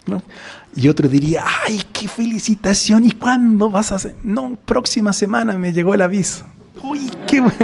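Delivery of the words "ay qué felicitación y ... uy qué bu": high, excited chatter between friends; high-pitched, gushing congratulations over happy news; gushing neighbours. Acted. Acted chat between neighbours.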